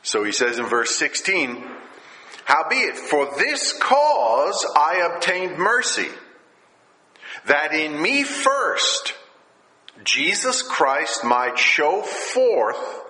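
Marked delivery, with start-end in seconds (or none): 2.5-13.0 raised, slow preacher's reading of scripture; preaching scripture. Acted. Pastor reading scripture to his congregation, raised and slow. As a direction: proclaim the verse high and slow like a preacher.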